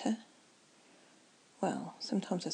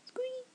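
a tiny squeak much higher, like a guinea pig; 3 dB quieter than usual. Retold or imitated. Explaining guinea pigs to viewers, a high mock squeak. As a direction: squeak like a small pet.

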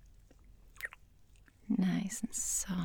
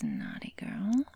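usual speaking voice

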